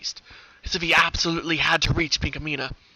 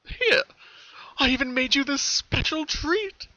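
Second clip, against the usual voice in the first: high, slow sinister sweetness; creepy colt voice; 13 semitones higher, 4 dB louder, slower than usual. Acted. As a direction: coax her like a cruel captor. Villain colt voiced in story.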